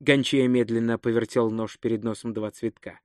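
plain reading voice

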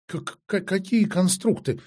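high, frightened stammer; fearful, halting high voice; trembling moan. A narrator's reading. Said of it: "простонала трепещущая Марион".

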